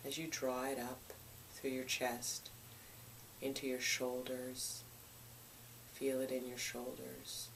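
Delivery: calm, hushed meditation voice with long pauses; soothing, even-toned visualization, gradually softening; guided meditation. Real, not acted. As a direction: guide them gently through the body, phrase by phrase.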